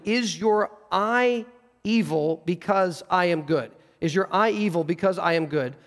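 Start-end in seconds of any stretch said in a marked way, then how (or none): 0.0-3.7 slow, deliberate question, weighing each word; slow literal rendering. Shown as a demonstration. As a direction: draw out "eye evil" for emphasis.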